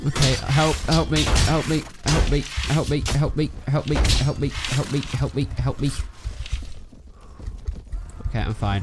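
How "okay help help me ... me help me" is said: playful panicked pleading; mock panic. Calling for help mid-battle.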